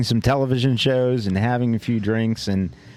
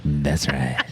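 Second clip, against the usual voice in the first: much lower, slow announcer echo; deep announcer voice; 8 semitones lower than usual. Pretend. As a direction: drop into a booming radio voice. Deep echo of his own line.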